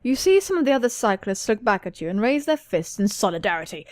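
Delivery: usual speaking voice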